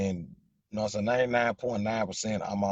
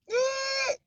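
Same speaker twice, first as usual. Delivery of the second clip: short, much higher squeal. Real, not acted. Reacting to a viewer's comment with a sudden squeal.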